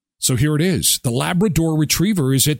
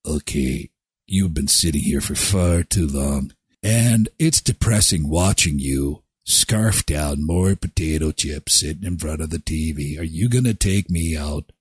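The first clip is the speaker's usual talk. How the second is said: dog's voice; 11 semitones lower than usual. Acted. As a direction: voice the dog, low and bored. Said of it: "They're looking up at us saying".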